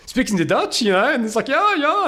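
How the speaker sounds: high, loud delighted outburst; very high-pitched eager agreement; retold excited exchange. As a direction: act out two men thrilled to share German. Acting out excited exchange in German.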